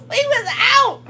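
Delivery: high squealing laugh through the words. Real, not acted. Telling of running from the snake, laughing high. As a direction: giggle it out, can't stop laughing.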